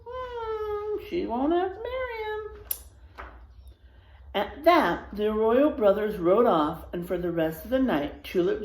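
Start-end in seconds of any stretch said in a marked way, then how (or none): 0.1-2.5 musing. Acted. Musing aloud to children.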